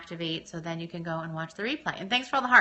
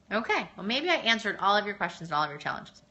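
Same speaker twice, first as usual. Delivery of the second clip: normal talking voice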